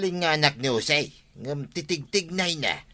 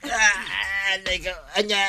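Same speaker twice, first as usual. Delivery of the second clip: pained cry; 4 semitones higher than usual. Acted. Injured man cries out in pain.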